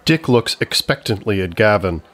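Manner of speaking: usual speaking voice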